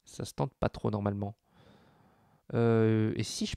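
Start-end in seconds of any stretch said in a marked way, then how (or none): none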